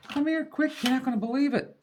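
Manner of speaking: high, eager urging; excited call. Retold or imitated. Husband calling wife to window, high excited urging. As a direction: wave her over, can't wait to show.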